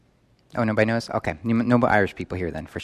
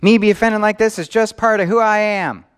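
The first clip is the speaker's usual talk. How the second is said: high, loud, insistent excuse-maker; defensive excuse; 9 semitones higher, 7 dB louder than usual. Acted. Preacher mimicking someone excusing their offense, loud and defensive. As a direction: voice a loud, high self-justifying protest. Voices an excuse people make.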